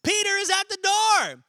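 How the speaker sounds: high, loud, drawn-out announcement; overjoyed exclamation. Acted. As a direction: burst out with thrilling news. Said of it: "she was so overjoyed".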